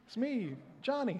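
put-on high, small child's voice introducing himself; kid voice. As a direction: say it like a little boy, lightly voiced. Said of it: "'cause he'd be like".

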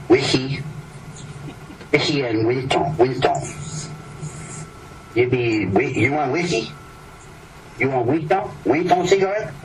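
a hint of a pushy waiter touting drinks and cigarettes; imitating a waiter. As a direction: call it out like a lobby waiter pushing whisky. Peddling waiter hawks whisky, cigarettes.

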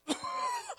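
put-on coughing fit; acted sick cough. Sick person coughs while coming in for prayer, acted cough. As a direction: cough like someone ill. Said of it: "Someone comes in and you're like".

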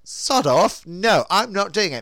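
mock defiant snap. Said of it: "And I'm like".

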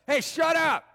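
high, loud acted telling-off; crowd yelling. Crowd yells at the blind beggar to hush, shouting. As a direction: yell it to silence someone. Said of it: "They yelled at him".

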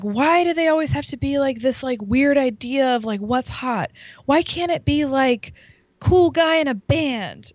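a high, complaining mock of the host's speech; high mock whine. Mimicking the host on stage, high and whining. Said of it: "so then she's like".

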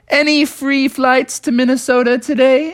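put-on high, bright-eyed young bear asking; Bubby's hopeful voice. Young bear asks the airport desk for a free flight, high and eager. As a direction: pitch way up like an eager kid. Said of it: "walked right up to the front desk and said".

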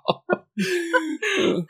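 a high, wheezing burst of laughter. Real, not acted. Host losing it over co-host's accent, high wheezing laugh. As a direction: crack up high and helpless.

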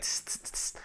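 soft hissed hi-hat; imitated cymbal ticks. Drummer slamming away on the kit, imitated cymbals. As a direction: tick the beat softly through the teeth.

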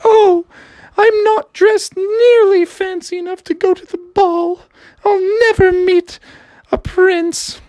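high, breathy-free lilting princess. Acted. Princess telling Froggy about her sisters, high voice. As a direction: play the upset princess in a high voice. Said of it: "she's like".

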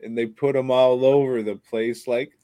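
ordinary delivery

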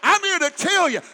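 loud preached scripture; full-force sermon reading; preaching. Acted. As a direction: declaim it to the congregation. Climactic preaching.

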